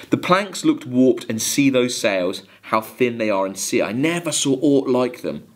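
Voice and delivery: a steady, measured recitation of a ballad; poem reading. Acted. Teacher reading Coleridge to students, measured poem recitation. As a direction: read the verse to the class with care.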